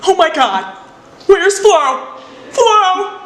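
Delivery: high, rising alarm; panicked realization. Acted. Deborah realizing her fish is left in the car, panicked. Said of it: "Deborah realizes".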